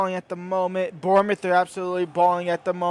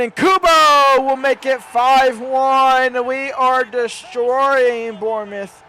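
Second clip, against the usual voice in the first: louder, slow triumphant call; excited goal call; 5 semitones higher, 7 dB louder, slower than usual. Acted. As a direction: savour the fifth goal. Live goal commentary.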